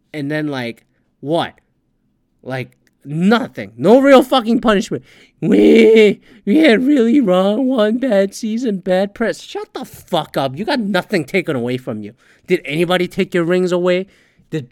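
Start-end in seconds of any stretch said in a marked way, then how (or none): 5.4-9.4 high, louder mocking whine of the team; mock team excuse. Host mocking the team's excuse, whiny parody. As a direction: parody their lame excuse.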